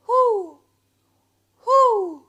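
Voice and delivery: loud, high hooting whoo of an owl; owl hoot imitation. Describing night sounds at her house, loud high owl hoot. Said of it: "from the owl that lives over there".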